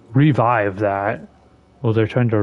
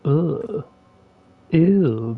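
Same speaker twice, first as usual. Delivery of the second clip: ordinary talk, nothing marked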